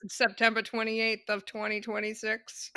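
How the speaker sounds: normal talking voice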